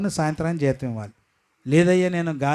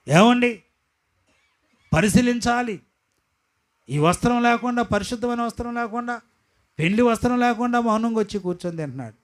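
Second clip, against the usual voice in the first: full-force preaching; 7 semitones higher than usual. Acted. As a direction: drive each phrase home.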